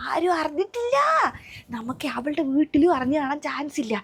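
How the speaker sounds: ordinary delivery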